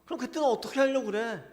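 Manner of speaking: God loudly pressing Jeremiah to face worse trials; God's stern challenge. Retold or imitated. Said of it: "하나님 지금 그렇게 말씀하고 계시는 거예요".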